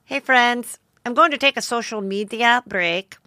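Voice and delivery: put-on Spanish accent, carefully enunciated; mocking Spanish-accented post reading. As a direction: read it as Hilaria Baldwin with a Spanish accent. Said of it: "I have to do the accent".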